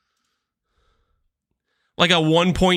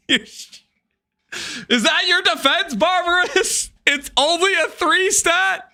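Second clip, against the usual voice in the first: squeaky, much higher incredulous whine; mock outrage; 11 semitones higher than usual. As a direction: whine it back at him in disbelief. Mocking a chat excuse.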